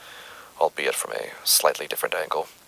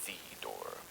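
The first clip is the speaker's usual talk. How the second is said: much softer and lower, barely there; very quiet; 10 semitones lower, 25 dB quieter than usual. Retold or imitated. Quoting a killer who went quiet, far softer and lower. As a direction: let it sink to almost nothing. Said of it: "Curtis became very quiet and simply reiterated".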